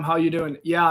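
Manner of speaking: normal talking voice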